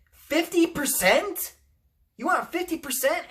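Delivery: high, disbelieving repeat of the price; can't believe the demand; incredulous. Real, not acted. Vlogger scoffing at Disney's demand, high incredulous.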